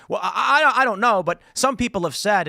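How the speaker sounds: high, rattled mock reporter changing the subject; hammed-up cornered reporter; flustered journalist. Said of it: "And he's like".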